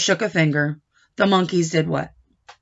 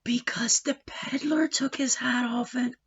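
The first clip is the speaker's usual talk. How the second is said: whispered explanation; 10 dB quieter than usual. Real, not acted.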